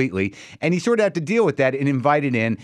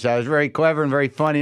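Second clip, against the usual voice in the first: usual speaking voice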